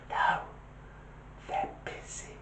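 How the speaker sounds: put-on tiny hushed child on the phone; little whispered voice. Little child answering a caller, whispering. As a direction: whisper it softly, like a secretive little kid. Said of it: "a little voice answered".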